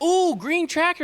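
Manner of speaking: put-on high, slow little-kid voice; imitating his toddler son. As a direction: squeak it out like an excited toddler. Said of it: "he'd be like".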